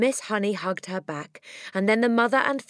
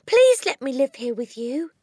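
high, loud pleading cry; a child begging with all her heart; cried out; 9 semitones higher, 7 dB louder than usual. A narrator's reading. Matilda cries out to Miss Honey, pleading loudly. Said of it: "Matilda cried out".

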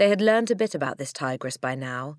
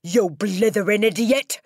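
loud, raised roar of contempt; angry shout; 6 semitones higher, 8 dB louder than usual. Acted. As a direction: shout the insult as an enraged headmistress. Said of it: "shouted the Trunchbull".

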